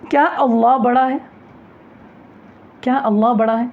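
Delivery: کیا اللہ بڑا ہے؟ said as a question, rising on کیا; question tune. Shown as a demonstration. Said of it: "استفہامیہ جملہ بن جاتا". (کیا اللہ بڑا ہے؟ سوالیہ لہجے میں)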